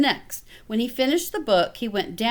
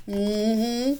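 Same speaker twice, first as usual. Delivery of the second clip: plain reading voice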